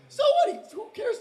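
very high, shrill-sounding scoff; mock dismissive squeak. Player mocking imagined listeners, in a high mock scoff. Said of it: "in case people are like".

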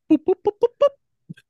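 very high, loud boop noises; mock boop sounds. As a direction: squeak out cartoon boops. Sound effect while pulling.

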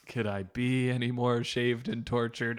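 a hint of a whiny, sarcastic whine; Chandler Bing. Tortured roommate in the closet mocks his fate, lightly voiced sarcasm. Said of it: "Chandler Bing tied up and tortured".

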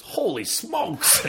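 very high, slow mock exclamation; Stan Lee impression. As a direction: stretch it out in a squeaky amazed voice. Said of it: "Stan Lee's over there and goes".

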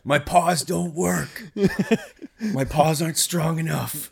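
high, slow cartoon dog voice; a desperate dog pleading; grieving dog. Dog husband voiced in comic scene.

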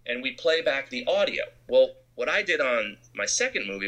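normal talking voice

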